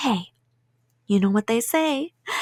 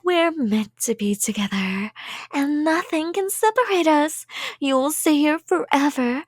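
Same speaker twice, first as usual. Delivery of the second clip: ordinary talk, nothing marked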